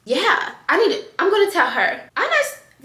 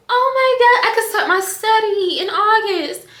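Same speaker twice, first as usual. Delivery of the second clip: high and louder, thrilled; real excitement, much higher; 10 semitones higher, 4 dB louder than usual. Real, not acted. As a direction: burst out with joy. Realising she'll be candidate.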